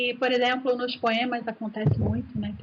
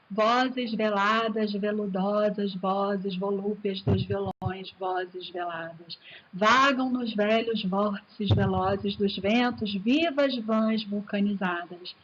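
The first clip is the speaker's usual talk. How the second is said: alliterative poem reading; 4 semitones lower, slower than usual. Shown as a demonstration. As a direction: savour each V slowly. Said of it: "Essa repetição do V". (leitura lenta e suave, destacando cada V)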